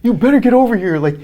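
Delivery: high, loud, hammed-up secretary voice; secretary phoning in a panic, high and loud; secretary's panicked call. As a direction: squeal it like a frantic assistant on the phone. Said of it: "And he's like".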